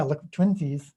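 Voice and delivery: normal talking voice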